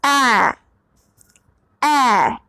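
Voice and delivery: ए held as a clear e; teacher sounds out ए twice; plain long ए; vowel demo. Shown as a demonstration. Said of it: "हम इस मात्रा को क्या पढ़ते हैं?".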